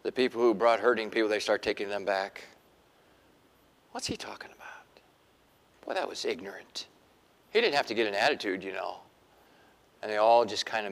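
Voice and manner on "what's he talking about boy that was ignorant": whispered complaint; leaving crowd whispering their disgust; grumbling crowd. Retold or imitated. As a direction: whisper it behind his back. Departing crowd grumbling aside.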